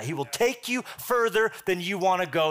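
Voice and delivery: normal talking voice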